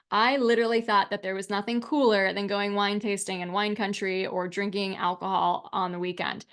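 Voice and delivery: ordinary talk, nothing marked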